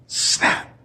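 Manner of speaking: sharp loud snap sound effect; mousetrap snap. Retold or imitated. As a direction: mimic the trap slamming shut. Mousetrap going off at night.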